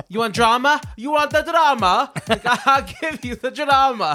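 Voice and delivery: high, hammed-up Russian accent. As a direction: taunt them in a thick Russian accent. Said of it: "I love your Russian accent".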